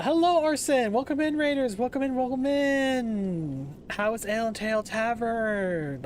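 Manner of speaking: bubbly streamer welcome, stretched out; cute anime streamer. Real, not acted. Streamer greeting raid.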